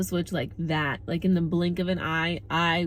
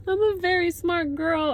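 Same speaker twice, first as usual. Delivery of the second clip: pitched way up, mock-proud; high playful boast; 11 semitones higher, 4 dB louder than usual. Real, not acted. Vlogger repeats her boast to viewers, high and mock-proud. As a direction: sing it out high like a proud brag.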